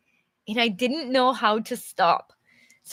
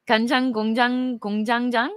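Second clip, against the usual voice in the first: 간장 공장 공장장 fired off very fast; determined fast try; much faster than usual. Shown as a demonstration. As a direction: race through 간장 공장 공장장 as fast as possible. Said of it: "I'm determined".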